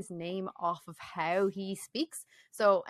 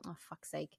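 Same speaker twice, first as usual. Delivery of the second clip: muttered fuck's sake; 5 semitones lower, 17 dB quieter than usual. Retold or imitated. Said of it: "they'll just go".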